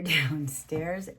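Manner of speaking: usual speaking voice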